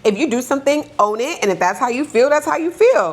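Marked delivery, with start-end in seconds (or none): 2.3-3.1 firm, emphatic, higher and pushed; said with your chest. Real, not acted. Mom telling listeners to own feelings, emphatic and firm. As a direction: land it with full conviction. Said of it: "You said it with your chest".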